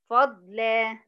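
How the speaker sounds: "فضلا" as fad-laa, final vowel held; stop with madd. Shown as a demonstration. Teacher repeating the pause form, "فضلا" with long aa. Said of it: "هقف عليها بحرف مد طبيعي حركتين".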